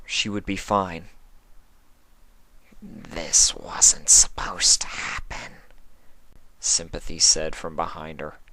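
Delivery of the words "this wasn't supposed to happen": slow breathy whisper; a seductive hushed question; whispering changeling. Acted. Sympathy asking Twilight in a slow whisper.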